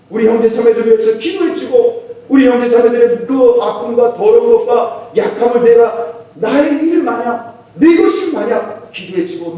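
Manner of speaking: loud, high, impassioned sermon climax; fervent preaching. Acted. Preacher urging the congregation to pray for others, fervent and loud. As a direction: preach it at full force, pleading. Preacher urges prayer for siblings.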